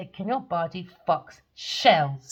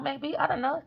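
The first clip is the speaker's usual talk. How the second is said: ordinary delivery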